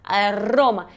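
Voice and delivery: "aroma" as "a-rrroma", tongue vibrating; strongly trilled R. Shown as a demonstration. Teacher repeating the strong R, rolled trill. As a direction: roll hard into the middle of "aroma". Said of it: "só que vai vibrar mais".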